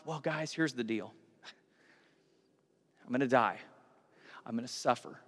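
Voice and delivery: low, soft, grave delivery; subdued announcement. Acted. Jesus tells the crowd he will suffer, subdued announcement. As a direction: break the bad news quietly.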